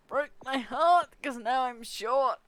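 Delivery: loud, slow, drawn-out lament; mock heartbreak. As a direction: wail it with hammed-up grief. Mock lament about being short.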